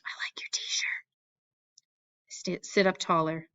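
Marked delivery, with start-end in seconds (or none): 0.0-1.1 soft unvoiced whisper across the desk. A narrator's reading. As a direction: lean in and breathe the words. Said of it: "She nods back and whispers".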